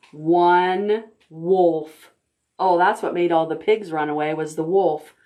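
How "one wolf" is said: ordinary delivery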